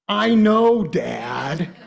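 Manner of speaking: put-on exasperated kid. Daughter brushing off dad's warning, exasperated and loud. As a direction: toss it back like a fed-up daughter. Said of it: "the response that we usually get now".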